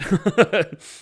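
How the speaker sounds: a sudden loud burst of laughter, high. Real, not acted. Laughing hard at the smashed face, loud laugh. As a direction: crack up loudly at the gross image.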